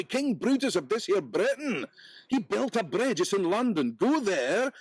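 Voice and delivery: high, bright-eyed hart, impatient with the peddler; a magical hart fussing over its own rhyme; talking hart. Acted.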